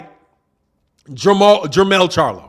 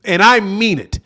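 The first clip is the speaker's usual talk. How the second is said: ordinary talk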